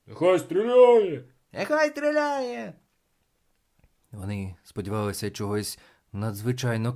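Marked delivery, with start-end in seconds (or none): 0.1-1.2 loud, high, drawn-out shout; clamouring crowd. A narrator's reading. Crowd demanding the boy may shoot, loud slow shout. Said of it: "люди зняли галас". 1.5-2.7 very high, drawn-out cry; clamouring crowd. A narrator's reading. Second onlooker demanding the boy shoot, high thin cry. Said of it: "люди зняли галас".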